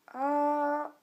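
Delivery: "A" drawn out through a slow dip; dipping tone. Shown as a demonstration. Teacher models the third tone, long dipping "A". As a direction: stretch "A" like an upside-down circumflex. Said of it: "il est descendant".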